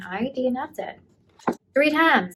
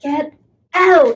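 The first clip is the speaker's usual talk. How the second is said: raised, loud mock-tough order; badass command; 5 semitones higher, 7 dB louder than usual. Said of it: "[name] being the badass".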